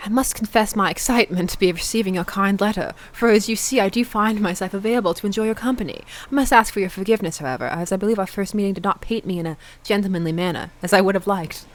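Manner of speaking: hammed-up formal British gentleman; fake British accent. [name]'s courtly reply to a message, fake British accent. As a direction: read it in an atrocious faux-British accent. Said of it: "my atrocious fake faux British accent".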